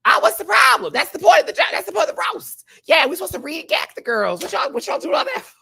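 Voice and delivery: high, loud indignant shouting; shrill outrage at the critics; outraged yelling. Real, not acted. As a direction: yell it like the roast was wronged. Outraged defending the roast jokes.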